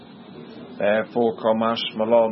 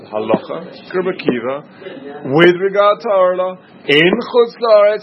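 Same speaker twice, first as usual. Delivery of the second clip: "Halacha K'Rabbi Akiva, with regard to Orla, in Chutz La'aretz" phrase by phrase, building louder; stressing every word; 4 dB louder than usual. Shown as a demonstration. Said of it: "had to stress every word".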